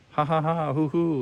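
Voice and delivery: high hooting laugh; kookaburra laugh. A narrator's reading. Kookaburra laughing at Tinka's mistake, hooting. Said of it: "laughed the kookaburra".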